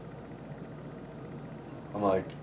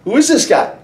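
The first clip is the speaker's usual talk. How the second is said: retold indignation; 11 semitones higher, 4 dB louder than usual. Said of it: "he's like".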